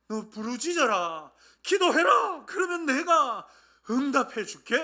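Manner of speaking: high, loud, drawn-out divine command; commanding shout. Acted. God urging the people to cry out, commanding shout. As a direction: proclaim it at full volume. Preacher voices God's command to pray.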